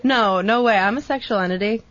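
ordinary delivery

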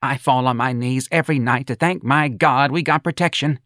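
high, declaiming mother; fervent mother. A narrator's reading. Mother telling the crowd of her thanks, high and fervent.

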